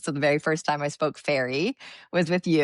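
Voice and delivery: normal talking voice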